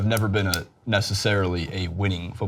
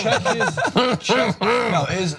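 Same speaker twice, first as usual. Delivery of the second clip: high, laughing through the words; laughing impression; 11 semitones higher than usual. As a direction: giggle like an overjoyed commentator. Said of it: "He doesn't have to laugh".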